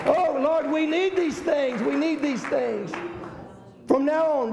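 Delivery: high, slow impassioned plea; fervent preaching. Real, not acted. Pastor pleading before the church, impassioned. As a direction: cry out to the Lord, slow and high.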